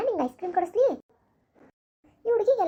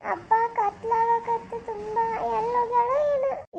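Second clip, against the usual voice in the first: put-on slow, higher tearful child's voice breaking into sobs; crying child; 5 semitones higher, slower than usual. Daughter crying to her father about her missing sister. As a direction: sob it out like a scared little girl. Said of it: "ಈಗ ನೀನು ಅಳಬೇಡ".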